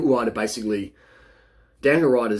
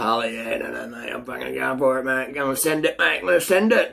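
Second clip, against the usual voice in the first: cocky rider; 3 semitones higher than usual. Retold or imitated. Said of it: "They're like".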